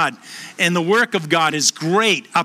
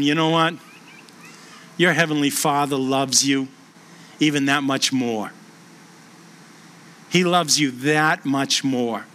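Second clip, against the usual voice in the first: tender reassurance; 6 semitones lower, 3 dB quieter than usual. Real, not acted. Chaplain gently telling the man he is loved, low and soft. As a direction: speak it gently, as comfort.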